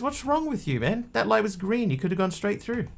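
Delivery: very high, exasperated voice; baffled passenger. Acted. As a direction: pitch it up in outraged disbelief. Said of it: "guy says".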